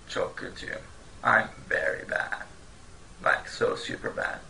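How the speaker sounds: breathy, drained whisper of misery; weak, defeated whisper for very bad; miserable whisper. Shown as a demonstration. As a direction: sound like you feel awful. Acting out feeling very bad.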